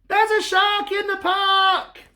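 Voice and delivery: put-on high, slow childish cry; boy crying out. Timothy crying out about a shark. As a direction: squeal it out like a scared little boy. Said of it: "what he's about to shout".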